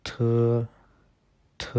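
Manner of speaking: थ said as an aspirated dental th; थ, the Chinese t sound. Shown as a demonstration. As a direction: puff air after थ. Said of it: "इसको हम बोलते हैं".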